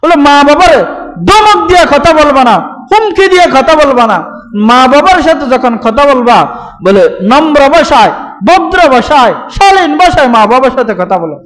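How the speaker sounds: উচ্চস্বরে জোরালো ওয়াজ; fervent preaching. Acted. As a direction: drive the command home to the crowd. Preacher rising to fervent peak.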